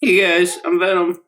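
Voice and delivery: high, loud mock greeting; Venom. Trailer villain popping up at the end, mock high-pitched greeting. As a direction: pop up with a comically chirpy hello. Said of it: "Venom kinda popped up at the end".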